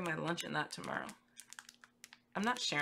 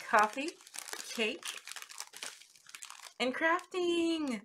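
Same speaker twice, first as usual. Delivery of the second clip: excited shout; 7 semitones higher, slower than usual. Real, not acted. Reading the personalized gift label, excited high shout. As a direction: shout it out in pure delight. Reads gift name, overjoyed.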